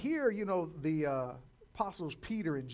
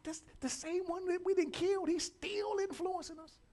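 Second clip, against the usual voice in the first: alarmed priests; 12 semitones higher than usual. Retold or imitated. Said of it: "It's like".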